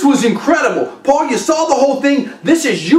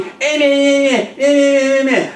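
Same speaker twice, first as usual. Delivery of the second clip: mocking yapping; 3 semitones higher than usual. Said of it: "That guy was talking".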